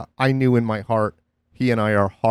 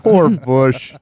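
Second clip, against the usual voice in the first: mock pity; 6 dB louder than usual.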